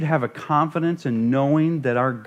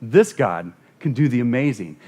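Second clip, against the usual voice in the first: usual speaking voice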